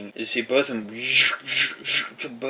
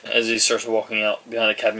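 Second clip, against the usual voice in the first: ordinary talk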